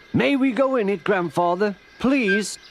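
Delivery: put-on high, loud pleading child's voice; child begging grandfather. A narrator's reading. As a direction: beg like an eager little kid. Children beg their grandfather.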